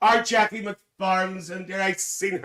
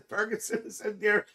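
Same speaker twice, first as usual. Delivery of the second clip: ordinary talk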